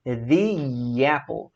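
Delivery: "The apple" very slow, thee with a Y glide; thee-yapple. Shown as a demonstration. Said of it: "fica mais ou menos assim".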